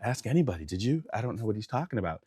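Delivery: soft, unbothered denial; quiet innocence. Retold or imitated. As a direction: ask it softly, all innocence. Playing innocent before witness, quietly.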